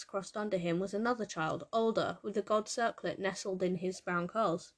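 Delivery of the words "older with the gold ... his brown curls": usual speaking voice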